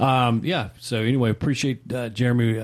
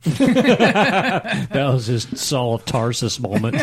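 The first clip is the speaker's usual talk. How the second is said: laughing; 4 semitones higher, 4 dB louder, much slower than usual. Real, not acted. Laughing at biblical joke.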